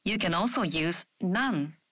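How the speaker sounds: ordinary talk, nothing marked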